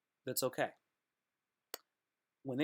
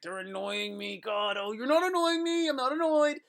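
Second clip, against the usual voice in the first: high whine climbing louder; mock denial; 10 semitones higher, 4 dB louder than usual. Someone who can't face being annoyed, mock whining denial. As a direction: climb into a hammed-up high protest.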